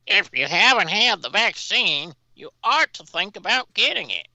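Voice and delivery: high, wobbly mocking politician voice; lilting falsetto parody of a senator; Graham impression. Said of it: "he began".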